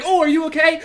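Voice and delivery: mock her alarmed check-in; worried girlfriend.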